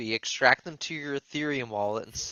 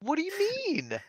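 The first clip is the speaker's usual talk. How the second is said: much higher, playful fake innocence; high mock innocence; 5 dB quieter than usual. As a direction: play dumb in a squeaky voice.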